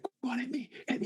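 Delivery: high, insistent goading; mock screaming taunt. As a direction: imitate a sister screaming a dare. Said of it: "And she's screaming".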